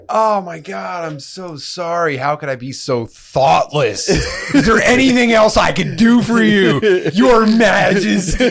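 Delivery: high, slow, fawning apology; hammed-up servile plea to Your Majesty, high and slow; ramped-up grovelling. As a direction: grovel as if to royalty, drawn out. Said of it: "If you gotta ramp it up".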